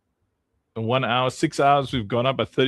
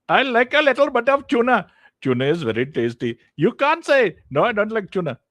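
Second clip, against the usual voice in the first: squeaky joke voice; 9 semitones higher, 5 dB louder than usual. Pretend. Joking about tuna on stream.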